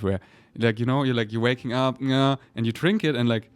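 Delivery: usual speaking voice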